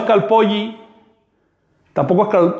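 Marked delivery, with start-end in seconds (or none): 0.0-0.8 calpolli with the double L held long. Shown as a demonstration. Teacher drawing out the LL of calpolli for students. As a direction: stretch the LL in calpolli. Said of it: "Hay que estirar esa L".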